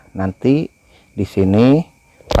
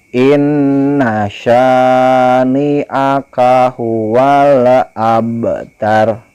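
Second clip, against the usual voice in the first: slow, melodic chant with long vowels; Quran recitation; 3 semitones higher, 3 dB louder, much slower than usual. Shown as a demonstration. Teacher models the verse for pupils, slow chant. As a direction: recite slowly, stretching each vowel. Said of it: "simak Bapak membaca".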